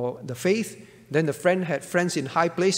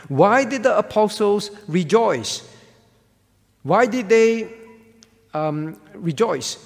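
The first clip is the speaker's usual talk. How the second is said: preaching loud; 4 semitones higher, 7 dB louder, slower than usual. Acted. Preacher's rhetorical questions.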